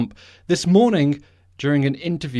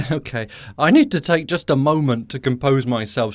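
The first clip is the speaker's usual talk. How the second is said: usual speaking voice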